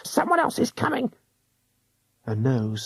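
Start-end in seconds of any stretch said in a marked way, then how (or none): 0.0-1.1 hushed hag warning. A narrator's reading.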